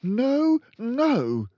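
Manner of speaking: high, loud protesting teacher; alarmed young teacher; crying out. A narrator's reading. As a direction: cry out to stop her. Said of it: "Miss Honey cried out".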